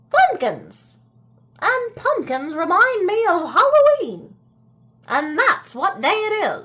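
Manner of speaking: put-on high, excited old woman remembering; lightly voiced old woman growing excited. Old woman tells her husband what day it is, high and eager.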